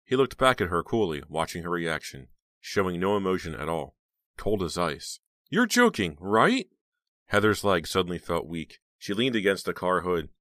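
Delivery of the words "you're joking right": high, loud, stunned disbelief; shocked disbelief. Acted. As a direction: ask it high and shaken.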